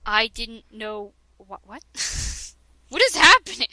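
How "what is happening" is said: mock bewilderment. Reading fanfic, confused outburst.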